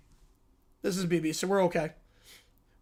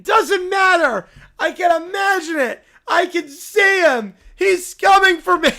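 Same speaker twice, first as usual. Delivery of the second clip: higher, louder half-laughing panicked cry; hysterical panic; 5 semitones higher, 5 dB louder than usual. Acted. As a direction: spiral into toddler hysteria. Ron Bear imagines SpongeBob.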